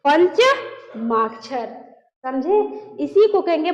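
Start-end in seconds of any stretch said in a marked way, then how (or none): 0.1-1.7 slow clear term. Shown as a demonstration. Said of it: "इसी को हम कहेंगे".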